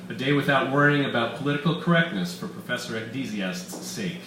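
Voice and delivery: usual speaking voice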